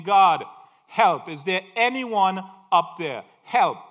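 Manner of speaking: loud desperate cry for help; crying out to God. Acted. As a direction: shout up to the sky for help. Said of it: "he's crying out to God".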